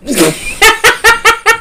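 loud high burst of laughter; big laugh. Real, not acted. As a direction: cackle out loud at your own joke. Bursts out laughing at own joke.

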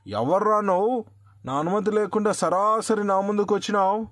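high, loud furious demand; angry king. A narrator's reading. King confronting the little man, a loud angry demand. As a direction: bellow at the intruder like an offended king. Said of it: "అన్నాడు కోపంగా".

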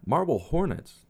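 ordinary delivery, nothing marked

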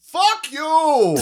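loud, high fuck you; mock angry. Retelling the movie scene to a friend, high loud mock snarl. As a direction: spit it out mockingly. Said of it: "he's just like".

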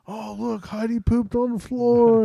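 high singsong pretend announcement; mock discovery. Kids pretend the dog made a mess, high mock discovery. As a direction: put on a high, play-acting voice. Play-acting with fake turds.

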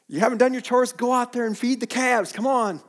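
high, loud parental scolding; dad scolding kids about chores, loud and high; parent ordering kids. Retold or imitated. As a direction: send the kids off to chores, sharply. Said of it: "Like I tell my kids".

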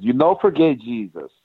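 imitated Cuban accent, words slurring together; Yoel Romero. Shown as a demonstration. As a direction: say "You don't forget Jesus" in a thick imitated Cuban accent. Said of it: "his accent made it come out as".

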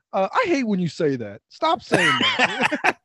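mock objection, rising into a squeal; Disco. Imitates co-host butting into argument.

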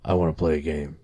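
low, soft sinister game voice; creepy villain voice. Retold or imitated. The creepy guy taunting at night, low and soft. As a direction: murmur it like a horror villain.

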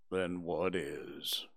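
low, slow, whispered and mournful; gloomy donkey Eeyore. Acted. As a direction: sigh it out like a sad old donkey.

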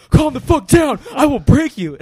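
screaming threat at full force; furious shout pinning someone down; yelling. Retold or imitated. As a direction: roar it down at the thief. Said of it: "So I'm like".

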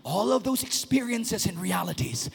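ordinary talk

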